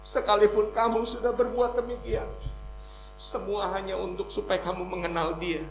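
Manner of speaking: forceful preacher's delivery; preaching. Real, not acted. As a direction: proclaim it to the congregation, building in force.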